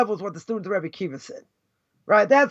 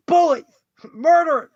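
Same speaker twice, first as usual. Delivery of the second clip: indignant quote; 4 semitones higher, 5 dB louder than usual. Retold or imitated. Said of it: "The person said to my face".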